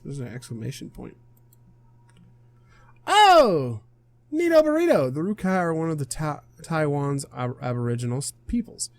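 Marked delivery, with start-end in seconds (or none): 3.1-5.1 high, loud burst of delight; excited. Acted. Player discovers a fun fact, excited and high. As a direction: exclaim it like a thrilled nerd. Excited reaction to the encyclopedia note.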